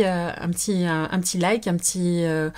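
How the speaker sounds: ordinary talk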